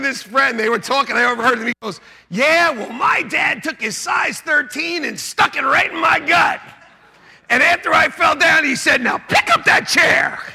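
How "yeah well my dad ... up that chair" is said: high, loud mock retelling; boasting kid's shout. Son bragging loudly to his friend about dad, high shout. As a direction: belt it out with slow, punched words.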